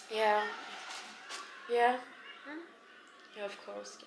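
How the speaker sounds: soft, murmured acknowledgements, play-acted; interviewed actress. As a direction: pretend to listen modestly to a TV host. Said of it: "comme si je parlais à la présentatrice".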